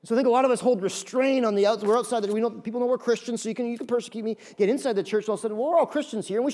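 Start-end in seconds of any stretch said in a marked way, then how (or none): none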